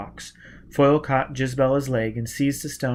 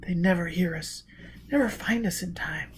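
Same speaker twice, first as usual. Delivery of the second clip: high, softer, whispered despair; hopeless girl's whisper; 5 semitones higher, 6 dB quieter than usual. A narrator's reading. Gisbella whispering to Foyle that no one will find them. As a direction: barely breathe the words.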